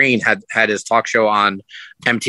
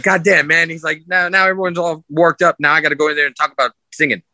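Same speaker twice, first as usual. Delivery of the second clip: higher, rushing mock complaint; quick, higher, half-joking grumble; mock exasperation; 6 semitones higher than usual. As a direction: play a star pretending to be put out.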